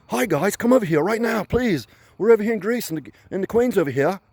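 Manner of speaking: high, loud put-on English accent; mock English accent. English actor in a movie about Greece, high mock English accent. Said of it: "English accent like Bear Vidge".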